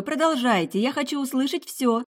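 lightly voiced eager healer; soul-healer's voice. A narrator's reading. Meryl the soul-healer speaks.

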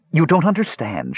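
high, lightly voiced pleading woman. A narrator's reading. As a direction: beg him to listen. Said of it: "she pleaded".

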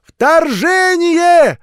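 very high, drawn-out shout; stretched, high-pitched child's holler; distant yell. A narrator's reading. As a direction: call it back from far down the street. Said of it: "ракетой метнулась прочь".

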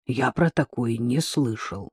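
low, soft, slow puzzlement; taken aback. A narrator's reading. Father-in-law baffled by the metro idea, taken aback. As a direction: say it quietly and slowly, nonplussed. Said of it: "опешил тесть".

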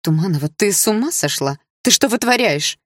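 indignant schoolgirl yell; angry shout. A narrator's reading. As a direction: bark it out in outrage. Classmate yelling at heroine.

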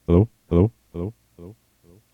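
hello echoing away to nothing; short call, repeats fading out; echo effect. Shown as a demonstration. Showing a friend an effect, fading echo. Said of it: "what about this?".

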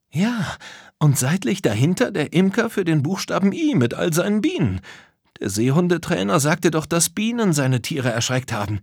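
high, keen character voice; eager discovery. Acted. A friend explains why the bees scared animals, eagerly. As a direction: chime in high, piecing the clues together.

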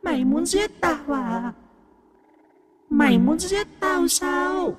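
high, slow, eerie female ghost taunt; mocking ghost. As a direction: drag the words out, sneering.

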